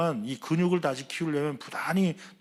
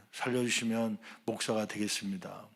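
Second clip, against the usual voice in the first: quiet vow; 4 semitones lower, 8 dB quieter than usual. Retold or imitated. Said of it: "목사가 되겠다고 선하더라고요".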